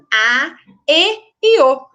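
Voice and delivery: "A, E e O" as closed â, ê, ô, firmly separated; closed vowel sounds. Shown as a demonstration. Teacher showing what the circumflex marks, closed vowels. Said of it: "um som mais fechadinho".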